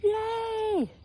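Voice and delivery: high, gleeful little cheer; bright happy yay; excited yay. Real, not acted. Cheering about new shed.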